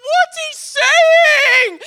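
high, slow, drawn-out desperate question; desperate plea. Retold or imitated. Begging the boy's mom to translate, high and slow. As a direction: stretch it out, pleading for help. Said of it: "And I'm like".

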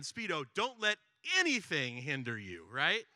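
normal talking voice

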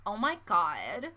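slow, low, sassy sigh. Shown as a demonstration. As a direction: drag it out with neck-roll sass. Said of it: "Proper sassy".

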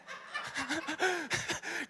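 high, breathy burst of laughter; a delighted high laugh between quotes. Real, not acted. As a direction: laugh at the picture of it. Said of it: "I think this is brilliant".

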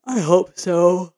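through a yawn; slow yawning words. Real, not acted. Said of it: "Sorry, I keep yawning".

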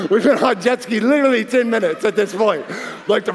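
talking through laughter; laughing. Real, not acted. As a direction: laugh while telling it. Laughing at the memory.

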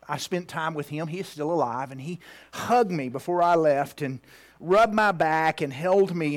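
ordinary delivery

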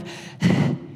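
a breathless puff of air; exhausted sigh. Retold or imitated. Crowd catching their breath after chasing Jesus, sighing. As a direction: blow out air like someone who just ran. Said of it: "I like to picture them out of breath".

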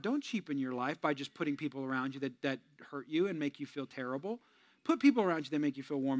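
ordinary talk

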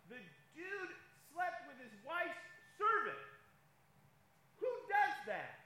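a preacher's loud, slowed outrage; incredulous outburst. Real, not acted. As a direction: rise in disbelief, then land it. Preacher indignant about Abraham.

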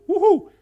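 loud high celebratory woohoo; big excited cheer; joyful whoop. Acted. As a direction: whoop like coming up from the water. Cheer at rising from water.

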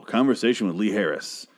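ordinary talk, nothing marked

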